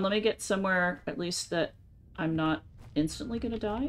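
usual speaking voice

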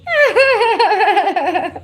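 high helpless burst of laughter; cracking up at the comment; real laughter. Real, not acted. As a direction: break up laughing. Keeps laughing.

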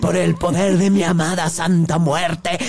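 slow ritual chant voice; incantation. Retold or imitated. Cursing the caller by the Santa Muerte, a slow incantation. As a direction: intone it like a curse. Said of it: "Y yo le decía".

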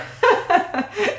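a rising high-pitched giggle. Real, not acted. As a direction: burst into a high little giggle. Laughing at cartoon Freddy onesie.

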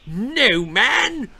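high, loud outrage; bristling princess. A narrator's reading. Princess outraged at the king, bristling. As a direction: bristle with indignation. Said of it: "bristled Princess Florrie".

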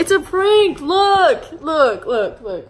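loud, high prank reveal. Real, not acted. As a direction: call it out over her. Revealing prank to upset girlfriend.

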